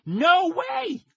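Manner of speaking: high-pitched shouted refusal; scream. A narrator's reading. Maxie refusing two friends' help, screaming. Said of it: "I screamed, spinning around".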